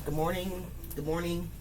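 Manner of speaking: quiet, fading good morning; soft greeting. Real, not acted. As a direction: let it drop almost to a murmur.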